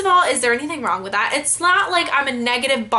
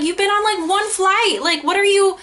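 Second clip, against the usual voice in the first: exasperated retort; 7 semitones higher than usual. Retold or imitated. Retorting to know-it-all sister.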